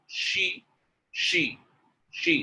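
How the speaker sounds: "she" with a full hushing sh; isolated, carefully said "she"; clear sh sound. Shown as a demonstration. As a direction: draw out the sh in "she". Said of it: "clear your sh sound".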